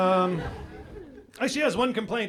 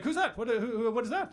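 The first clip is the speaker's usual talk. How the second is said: higher-pitched, stammered demands to know; suspicious questioning; 4 semitones higher, faster than usual. Retold or imitated. Wife sees Ting Ting on phone, suspicious questioning. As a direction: imitate a startled, suspicious wife.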